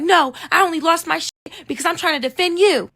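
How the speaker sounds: imitated high indignant protest; mock her self-righteous excuse; high mimicked indignation; blaming friend. Friend blames narrator.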